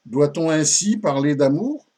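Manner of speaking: mildly affronted question, rising at the end; offended. Acted. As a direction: ask it as a shocked pupil rebuking her teacher. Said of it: "Marion commence par s'offusquer". (question offusquée, voix qui monte)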